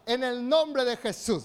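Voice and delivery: slow, loud call for the congregation to repeat; loud declaration. Shown as a demonstration. Preacher to congregation, leading the shout slowly. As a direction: boom it out so they echo you. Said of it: "Levante su mano y diga".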